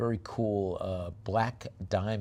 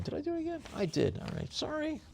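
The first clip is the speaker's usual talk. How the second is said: embarrassed squeak; 5 dB quieter than usual. Real, not acted. Embarrassed at mistake.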